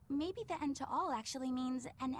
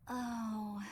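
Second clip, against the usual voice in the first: drawn-out, listless sigh of a word; lightly put-on lethargy, trailing off; Grimoire imitation; 4 semitones lower than usual. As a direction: let it fade away wearily. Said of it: "lets her sentences trail off".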